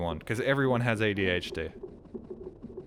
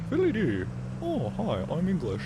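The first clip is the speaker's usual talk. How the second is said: put-on English accent; 4 semitones lower than usual. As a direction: play a lightly hammed-up upper-class English accent. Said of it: "than I can an English one".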